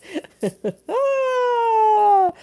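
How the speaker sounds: high, loud burst of laughter; real laughing fit; big laugh. Real, not acted. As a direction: crack up at the comment. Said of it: "reacting to a comment".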